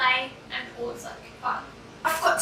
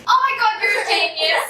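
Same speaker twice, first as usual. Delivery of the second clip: excited; 6 semitones higher, 8 dB louder than usual. Real, not acted. Delighted by sister's idea.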